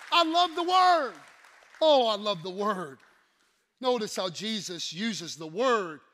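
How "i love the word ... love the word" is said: loud heartfelt cry; passionate preaching. Acted. Preacher declaring love of the word, loud. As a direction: lift it up to the rafters.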